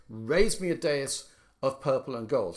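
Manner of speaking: the second verse read as an order, Raise first; stress on raise. Shown as a demonstration. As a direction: Raise hit hard at the front like an order. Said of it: "like beginning a bit of music".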